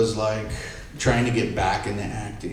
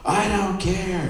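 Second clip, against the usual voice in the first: higher, drawn-out mock defiance; heated defiance; 8 semitones higher, slower than usual. Star's son before lunging at the stylist, heated defiance. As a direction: act out a slow, heated refusal. Retold fight, before he lunges.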